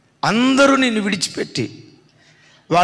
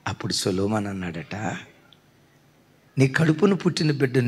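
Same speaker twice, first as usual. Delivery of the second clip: lower, grave and measured; solemn lowered voice; 5 semitones lower than usual. Acted. Preacher giving Solomon's final word to the mother, low and solemn. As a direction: sink the voice low and weighty.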